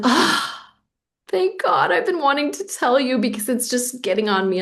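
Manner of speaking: relieved outburst. Retold or imitated. Telling her husband she is relieved he already knows, a high relieved outburst. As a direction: let it all out, as if a weight just lifted.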